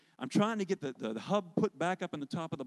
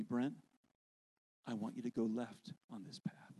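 low, soft, calm voice of God; a gentle, lower inner voice; God's voice; 5 semitones lower, 5 dB quieter than usual. Retold or imitated. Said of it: "I hear this same voice".

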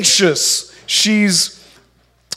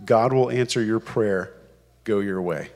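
a much lower, softer blessing; Eli the priest; 10 semitones lower, 8 dB quieter than usual. Acted. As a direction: hand down the promise gently and low, like a priest. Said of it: "Eli says to her".

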